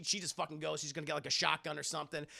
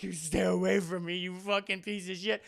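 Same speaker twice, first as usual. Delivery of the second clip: mock threat; 4 dB louder than usual. Woman threatens creature with knife.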